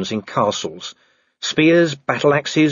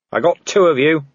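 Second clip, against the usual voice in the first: raised defiant shout; shouted boast; 5 semitones higher, 4 dB louder than usual. Acted. Vimes shouting back at the werewolf below. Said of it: "he shouted".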